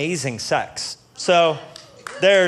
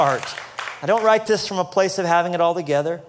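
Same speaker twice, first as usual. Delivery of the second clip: normal talking voice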